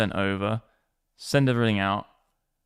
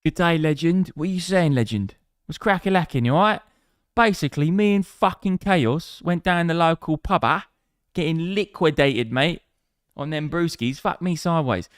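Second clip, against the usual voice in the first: hammed-up Cockney geezer, pitched up; mock Cockney; 6 semitones higher, 4 dB louder than usual. Streamer spinning a pub yarn, exaggerated Cockney accent. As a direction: play a matey Cockney pub storyteller. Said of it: "You sound like a proper".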